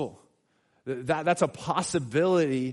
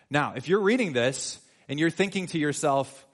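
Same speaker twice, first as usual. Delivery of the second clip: normal talking voice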